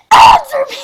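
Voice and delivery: angry demand. Acted. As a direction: yell the demand at him. Character demands an answer.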